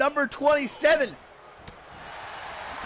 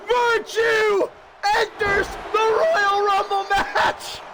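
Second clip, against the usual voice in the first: excited shouting; 6 semitones higher, slower than usual. Real, not acted. Surprise return announced.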